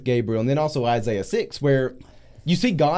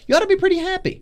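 mock cheerful; 4 dB louder, faster than usual. Real, not acted. Ironic aside about Zechariah.

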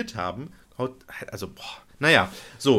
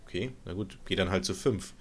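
resigned mutter; 5 semitones lower, 7 dB quieter than usual. Retold or imitated. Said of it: "Ich so".

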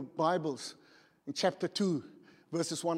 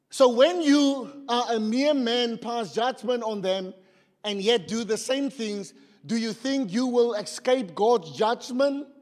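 slow, raised declamation; emphatic scripture reading; 5 semitones higher, 7 dB louder, slower than usual. Real, not acted. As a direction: declaim the verse as a challenge. Reads scripture aloud to congregation.

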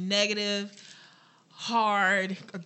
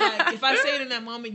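laughing; 4 semitones higher, 8 dB louder than usual. Real, not acted. Laughs while explaining her anger.

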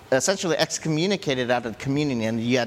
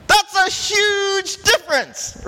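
sudden realization; 5 dB louder than usual. Retold or imitated. Preacher recalling studying the verse, high amazed realization. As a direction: sound struck by the discovery. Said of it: "I'm like, as I was studying this".

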